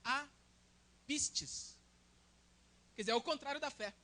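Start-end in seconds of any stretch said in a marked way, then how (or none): 0.0-1.8 apistis drawn out very slowly, syllable by syllable; Greek word spelled out. Shown as a demonstration. Preacher sounding out apistis slowly for the congregation. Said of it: "a palavra incredulidade é".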